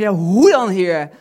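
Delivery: loud cry of hoe dan; loud desperate plea. Retold or imitated. Believer crying out to God in despair, loud plea. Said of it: "dat je denkt".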